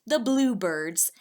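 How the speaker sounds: ordinary delivery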